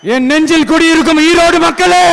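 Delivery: high, ringing rally address; hailing the crowd. Real, not acted. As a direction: call out to a whole crowd. (கூட்டத்தை உரக்க விளித்தல்)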